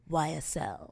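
low, understated cologne-ad voice; cologne ad model. Retold or imitated. Mocking Austin Butler's cologne ad, low ad voice. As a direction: lightly mimic a man in a perfume commercial.

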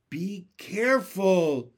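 usual speaking voice

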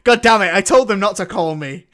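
joking put-on fury at the phone; mock annoyed outburst. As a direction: play Michael Caine fed up with Disney calling. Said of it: "Is this Disney on the phone?".

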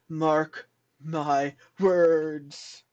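very slow, drawn-out warning; dramatic effect. Shown as a demonstration. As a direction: stretch it out like an omen. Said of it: "Had to add that dramatic effect".

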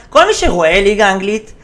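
usual speaking voice

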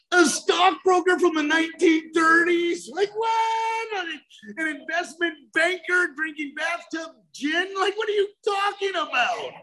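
high, shouted disbelief at the book; mocking his younger self, high and exasperated; incredulous rant. Retold reaction to the book.

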